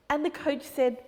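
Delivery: ordinary delivery, nothing marked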